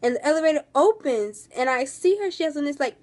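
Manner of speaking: ordinary delivery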